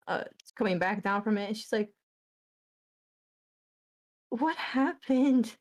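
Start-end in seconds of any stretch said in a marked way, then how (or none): 4.3-5.5 slow, rising higher into distressed disbelief; stunned and upset. Acted. Leaf on the floor telling Saheera what she saw, shaken. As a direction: voice climbing as the shock sinks in. Leaf in shock after vision.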